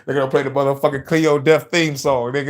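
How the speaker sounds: ordinary talk, nothing marked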